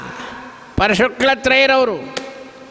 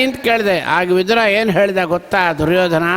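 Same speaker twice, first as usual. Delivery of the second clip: ordinary talk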